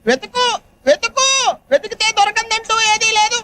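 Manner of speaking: high-pitched parroting of a pep talk; mocking imitation. Mockingly parrots the stranger's advice. (వెక్కిరిస్తూ అనుకరణ)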